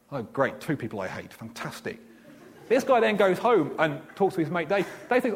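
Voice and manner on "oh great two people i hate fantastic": sarcastic grumble. Retold or imitated. Sarcastic reaction.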